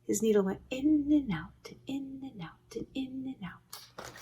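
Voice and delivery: unvoiced whisper of in and out; whispered refrain. A narrator's reading. Reader whispering the needle sewing in and out. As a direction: hush it to a whisper. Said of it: "whispered narration".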